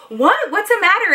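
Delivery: high, puzzled concern, imitating mom; worried mom. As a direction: pitch it up like an anxious mother. Worried mom cannot understand daughter.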